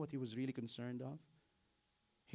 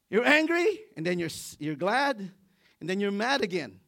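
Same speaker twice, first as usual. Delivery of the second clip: high, loud mock exasperation; teasing mockery; 6 semitones higher, 13 dB louder than usual. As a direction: poke fun at the fickle prophet. Mocking Jonah's mood swings.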